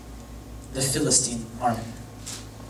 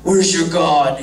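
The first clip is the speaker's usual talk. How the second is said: mocking giant; 5 dB louder than usual. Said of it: "he's just mocking them".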